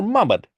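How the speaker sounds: Muhammad shortened to two syllables; alternative pronunciation. Shown as a demonstration. Lecturer offering another reading of bare letters, Muhammad shortened. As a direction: say Muhammad squeezed, without the first vowel. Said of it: "how do you pronounce that word".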